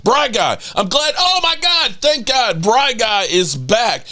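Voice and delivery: high-pitched, overjoyed outburst; excited relief. Real, not acted. Host welcoming a returning fan of the podcast, excited and relieved. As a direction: soar up in relieved delight.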